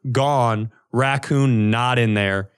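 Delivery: usual speaking voice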